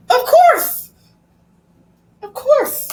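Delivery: aunt eagerly reassuring her upset nephew, voice up high. Retold or imitated.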